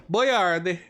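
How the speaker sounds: sing-song cartoon Italian; mock Italian accent. Streamer hams up an Italian accent, high and loud. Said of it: "that was my Italian accent".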